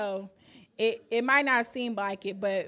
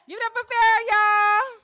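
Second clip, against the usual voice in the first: hype introduction; 12 semitones higher, 11 dB louder than usual. Real, not acted. Host hypes crowd for poet.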